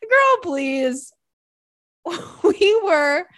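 high, loud, laughing words; cracking up. Real, not acted. Telling how she ruined everyone's day, cracking up. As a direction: let laughter take over the words.

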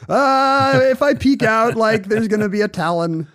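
playing the timid creature peeking out; squeaky mock panic; mock scared thought. Imagined fearful thought, hiding.

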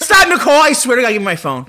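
high, loud protest; exasperated shout. Real, not acted. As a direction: yell at her to stop. Grabbing phone back.

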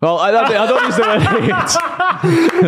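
laughing through the words, pitch jumping up high. Real, not acted. Denying he hates anyone, laughing hard. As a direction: giggle out a denial. Laughing denial of hating players.

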